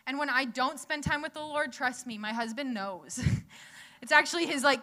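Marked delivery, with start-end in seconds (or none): none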